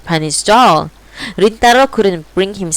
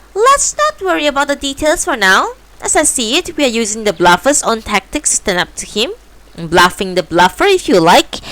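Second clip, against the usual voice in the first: cat voice; 7 semitones higher than usual. Acted. Cat's smug voice.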